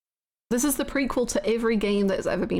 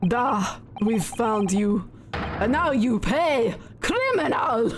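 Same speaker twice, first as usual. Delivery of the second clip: slow, gloating Russian villain; mock Russian captor gloating; Russian accent; slower than usual. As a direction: drag out the threat in Russian accent. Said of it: "I'm in character now".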